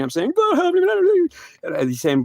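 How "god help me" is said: very high cry for help; desperate high wail to God; panicked plea. Retold or imitated. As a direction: beg in acted terror, voice shooting up. Acted plea while crashing.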